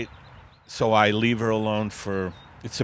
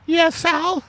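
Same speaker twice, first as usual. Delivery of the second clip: very high falsetto wife voice; a high put-on wife replying sweetly; Margaret voice. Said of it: "Normal people are like".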